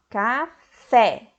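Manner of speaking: "Café" broken apart, landing firmly on fé; split, stress on last syllable. Shown as a demonstration. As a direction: separate "Café" as ca, then a strong FÉ. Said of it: "O som mais forte está na última".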